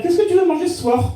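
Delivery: high-pitched wife asking; imitated wife. Wife asking her husband about dinner, high imitated voice. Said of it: "ta femme, elle va te dire".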